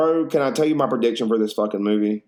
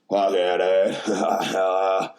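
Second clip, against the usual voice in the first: drawn-out mock cheer; 3 semitones lower, much slower than usual.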